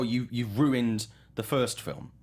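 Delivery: usual speaking voice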